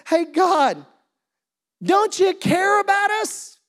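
high, loud, drawn-out plea; desperate retold outcry; freaking out. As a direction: call out to God like a panicked disciple. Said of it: "they start freaking out".

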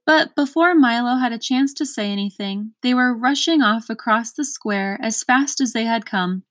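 ordinary delivery, nothing marked

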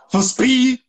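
high, incredulous echo; mock challenge. Teacher mocking demand for full speed, high challenge. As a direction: toss it up high as a dare.

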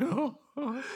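high laughing oh-oh of a worshipped hero; mock ecstatic moans. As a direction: moan with joy, half laughing.

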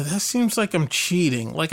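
usual speaking voice

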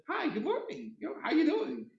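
high, soft, sugary mock greeting; masked fake friendliness. Imitating people who can't stand you, falsely friendly. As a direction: greet them sweetly to hide dislike. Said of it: "learned how to mask those things".

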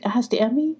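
ordinary delivery, nothing marked

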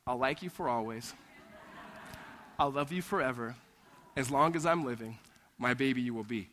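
put-on mother reading a cherished book to her boys, tenderly. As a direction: read it like a lullaby to your sons. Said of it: "Whenever she says those words".

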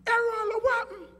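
very high falsetto; squeaky mock feminine voice; girl's question. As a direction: imitate a concerned girl asking. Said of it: "she says to me".

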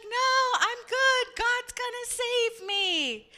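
very high call, stretched at the end; hammed-up, high-pitched brush-off; shouting back. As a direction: holler a refusal up to the helicopter. Man shouting back up at helicopter.

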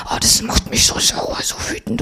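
angry whisper to yourself; whispering her anger while lying in bed. Retold or imitated. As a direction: seethe under your breath. Inner rage lying in bed.

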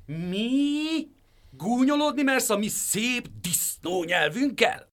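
outraged pig screeches; howling rage. A narrator's reading. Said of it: "úgy üvöltött, mint a sakál".

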